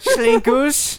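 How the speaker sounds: high-pitched "Slinkus" with a slushy "sh" for the first s; silly high lisp on "Slinkus". Acted. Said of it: "Slink definitely speaks with a lisp".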